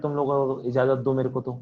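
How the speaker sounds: ordinary talk, nothing marked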